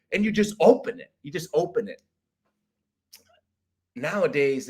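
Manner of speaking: usual speaking voice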